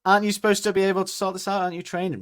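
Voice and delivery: high, fast complaining customer; mock whiny customer. Customer complaining to the hairdresser, high and fast. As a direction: plead like an indignant client. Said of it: "customer to hairdresser".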